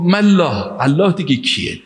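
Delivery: high-pitched, indignant retort; retold anger, scornfully challenging the answer; angry. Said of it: "این بیشتر عصبانی شد". (با عصبانیت و صدای بالا در نقل قول)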